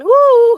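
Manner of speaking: high, loud "woo"; fervent whoop. Real, not acted. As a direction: let out a sudden preacher's whoop. Spontaneous preacher's exclamation mid-message.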